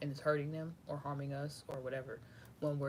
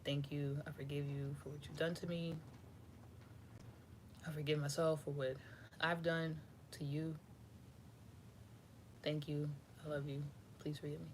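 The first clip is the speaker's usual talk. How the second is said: meditative prayer; 6 dB quieter than usual. Shown as a demonstration. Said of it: "you just do a meditation".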